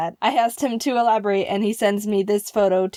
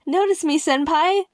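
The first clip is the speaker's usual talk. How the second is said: higher, sing-song "notice me, senpai"; mock anime fan plea; 6 semitones higher than usual. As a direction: gush it like a starstruck anime fan. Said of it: "they're saying things to him like".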